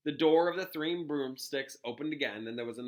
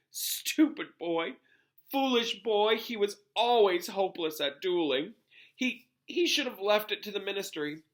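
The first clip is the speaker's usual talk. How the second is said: a higher, choked scolding, a touch louder; lightly voiced McGonagall, tearful and exasperated; McGonagall, thick with tears; 8 semitones higher, 4 dB louder than usual. A narrator's reading. McGonagall mourning the boy she scolded, choked up. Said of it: "blew her nose and said thickly".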